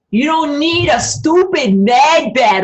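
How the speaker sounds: high, loud, slow shout; ranting shout. Real, not acted. Ranting at viewers about med beds, shouting. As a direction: yell it out in disgust at the idea.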